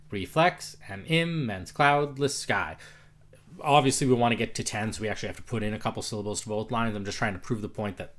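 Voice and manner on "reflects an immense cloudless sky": slow, each stressed syllable of "reflects an immense cloudless sky" hit hard; stressed meter. Shown as a demonstration. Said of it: "Actually say it like".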